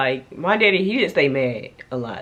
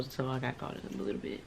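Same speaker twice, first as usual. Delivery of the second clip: sheepish murmur; 3 semitones lower, 14 dB quieter than usual. Real, not acted. Sheepish admission.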